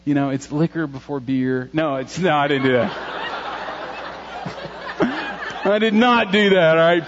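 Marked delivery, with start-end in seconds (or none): 3.0-5.6 real airy laugh at his own joke; an unvoiced burst of laughter; breathy laugh. Real, not acted. Pastor laughing at his own beer joke, airy laugh.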